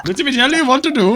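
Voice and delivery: put-on Indian-accented caricature; mock Indian accent. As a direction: imitate an Indian English speaker. Imitating Indian English speaker.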